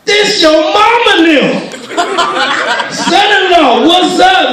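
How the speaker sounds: high-pitched, boisterous phone shouting; loud father-in-law. Retold or imitated. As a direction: boom a high greeting down the line. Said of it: "and he loud".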